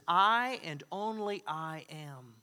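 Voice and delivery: "I and only I am" drawn out very slowly, each word weighted; emphatic, underlined. Retold or imitated. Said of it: "he used an emphatic form of saying that, as if to underline it".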